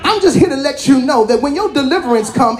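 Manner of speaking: normal talking voice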